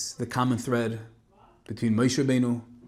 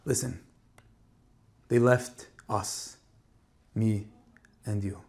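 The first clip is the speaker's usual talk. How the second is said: soft, tearful voice; words catching with held-back tears; choked up; 2 semitones lower, 4 dB quieter, slower than usual. A narrator's reading. Rebbe shares his own loss with the boy, choked up. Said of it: "his voice choked up".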